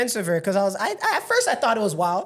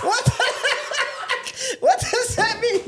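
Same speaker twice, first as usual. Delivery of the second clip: laughing camp voice; 13 semitones higher, slower than usual. Pretend. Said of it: "Stop doing that".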